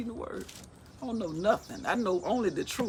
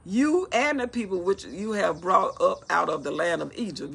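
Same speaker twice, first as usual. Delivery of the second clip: loud, declaimed Bible reading; scripture reading; 6 dB louder than usual. Acted. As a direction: proclaim God's command to Moses.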